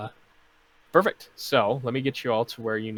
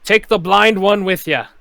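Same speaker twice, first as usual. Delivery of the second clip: loud, high barked command; bosun shouting orders; 9 semitones higher, 13 dB louder than usual. Acted. Bosun ordering Awen to swab the decks, loud shout. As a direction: snap the order at the deckhand. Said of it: "bosun continues".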